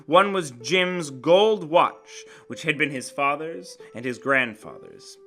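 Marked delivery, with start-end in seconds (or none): none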